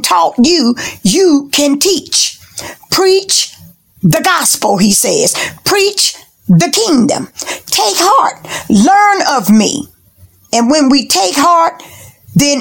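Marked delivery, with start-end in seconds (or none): 2.9-9.9 exhorting the congregation from the pulpit; high preaching calls. Acted. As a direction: lift each command up high.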